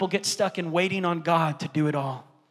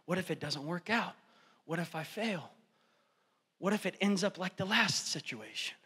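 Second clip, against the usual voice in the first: quiet worried; 10 dB quieter than usual. Retold or imitated. Fearful believers voiced.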